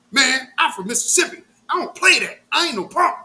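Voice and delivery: high, loud mock tough-guy boast. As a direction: ham up the little man's threat. Imitating Patrick's tough talk.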